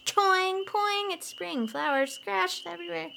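high bouncy boing sounds; springy cartoon sound effects; bouncy spring noises. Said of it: "mimics spring arriving".

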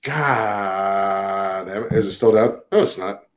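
quiet, far-off mic sound; like a dying mic; low gain hiss. Real, not acted. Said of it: "It's really quiet".

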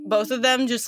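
normal talking voice

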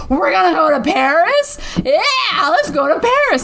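put-on a giddy drunk cheer; excited. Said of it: "He's, like, all excited".

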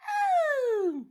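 high, loud mimicked yelp; imitated yelp. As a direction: let out a climactic little yelp, high and loud. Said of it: "yelp she does where it's like".

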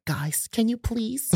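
high, soft pleading teacher voice; mock a teacher begging for quiet; teacher hushing students, mock pleading; imitating teacher. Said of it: "the teachers will come out like".

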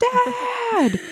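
put-on high, drawn-out kid squeal; mock scream. Mother imitating daughters greeting Dad at the door, mock scream. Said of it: "those girls scream at the top of their lungs".

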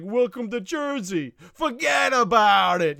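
slow Jersey wise-guy drawl; Jersey accent. Retold or imitated. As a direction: lay on a thick Jersey accent. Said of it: "the guy on the shore that's like".

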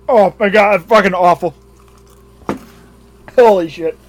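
loud, revolted cursing; disgusted outburst. Real, not acted. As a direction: blurt it out, grossed out. Tasting gross blue cheese.

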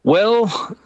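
normal talking voice

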